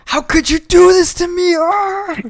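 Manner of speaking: high, slow, pleading hushed cry; whisper yell. Pretend. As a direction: cry out but keep it down. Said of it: "It's one of those".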